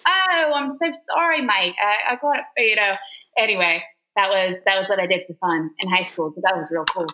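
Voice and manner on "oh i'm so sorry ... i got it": higher-pitched put-on Australian apology; chirpy teenage Aussie drive-thru girl saying sorry, mate; playful fake Australian accent, pitched up. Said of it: "this little Aussie girl".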